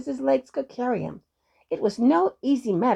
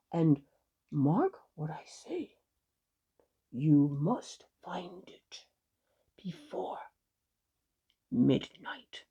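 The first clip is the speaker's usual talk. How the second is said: slow, hushed, lower warning; ominous warning; 5 semitones lower, 5 dB quieter, slower than usual. A narrator's reading. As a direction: drop low and leave heavy pauses. Fiddler gives a grave warning.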